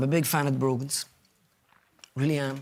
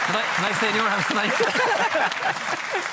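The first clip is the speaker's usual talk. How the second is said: higher, louder and slow, dragged-out lament; Pierce Doherty impression; 10 semitones higher, 4 dB louder, much slower than usual. Impressionist compares his marriage to Pierce Doherty, higher and slow. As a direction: stretch each word like a wounded plea. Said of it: "Donegal's Pierce Doherty".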